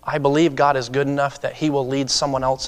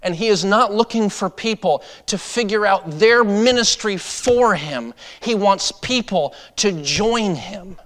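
raised preaching voice, higher and louder; 6 semitones higher, 5 dB louder, slower than usual. Real, not acted. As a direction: press the point to the church. Preaching builds up.

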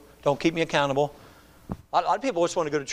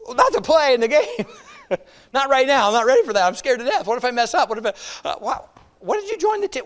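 mock scared player; 5 semitones higher than usual. Scared player answers coach.

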